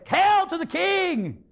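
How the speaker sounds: proclaiming crowd. Acted. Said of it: "the people would say".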